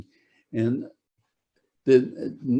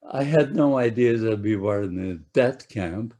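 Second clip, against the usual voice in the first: ordinary talk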